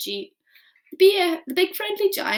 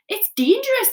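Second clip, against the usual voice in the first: much higher, louder disgusted cry; gurgling outburst; 11 semitones higher, 8 dB louder than usual. Acted. Giant disgusted by the snozzcumber, loud. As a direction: spit it out in disgust. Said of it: "the B F G gurgled".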